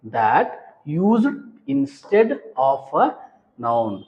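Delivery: word by word, very drawn out; teacher spacing out each word; slow dictation. Shown as a demonstration. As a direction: dictate it slowly for note-taking.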